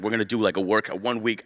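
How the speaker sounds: lightly put-on voice imitating a friend; mock friend's voice. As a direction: lightly mimic a buddy pitching a plan. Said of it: "I don't know why he talks like this".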